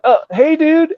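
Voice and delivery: high-pitched, sheepish mock hello; nervous greeting. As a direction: greet an angry friend in a small high voice. Acts timid greeting to angry bandmate.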